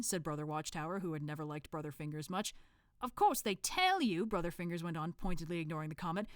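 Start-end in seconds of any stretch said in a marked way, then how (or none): 3.0-4.3 put-on Cockney accent. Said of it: "thick Cockney accent".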